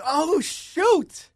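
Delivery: high-pitched mock alarm; retold startled reaction. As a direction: act out being caught off guard. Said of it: "I'm like".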